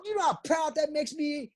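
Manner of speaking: nearly shouting how proud he is; proud outburst. Real, not acted. To the interviewer about his passport, a proud near-shout.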